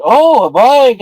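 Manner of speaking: high, loud, gushing mock squeal; excited imitation. Woman at the bar learns about the bike, imitated gushing excitement. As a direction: gush loudly, imitating her thrill. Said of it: "And she's like".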